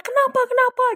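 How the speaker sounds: high, loud panicked questioning; retold panic. Rider asking friend while speeding, mock panicked yell. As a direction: act out a frantic cry for answers. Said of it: "ikutan panik dong ya kan".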